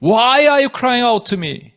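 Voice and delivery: high, slow, forceful reproach; loud rebuke. Retold or imitated. God rebuking Moses before giving orders, loud slow rebuke. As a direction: stretch it out loudly as a stern reprimand. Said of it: "책망하시는 것 같아요".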